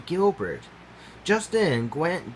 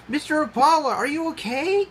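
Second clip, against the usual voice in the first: high, loud worried call; yell; 14 semitones higher, 4 dB louder than usual. Acted. Gilbert calling to the crashed impala, yelling. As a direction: call out to him in alarm. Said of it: "Gilbert yelled".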